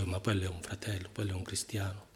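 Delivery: low, quick undertone to a neighbour; voce bassa. Acted. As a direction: murmur it softly aside, quickly. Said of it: "non la diciamo forte". (detto piano, di nascosto)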